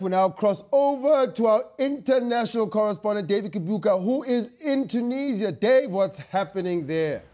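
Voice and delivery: news anchor. Acted. Mock news anchor intro.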